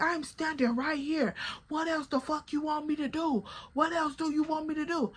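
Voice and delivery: imitating her own frustration; upset. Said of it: "'Cause now I'm upset".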